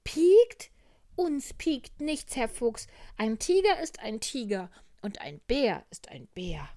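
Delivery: high, loud, defiant bear; little bear calling out. Acted. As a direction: protest proudly in a piping shout. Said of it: "rief der kleine Bär". (laute, trotzige Bärchenstimme)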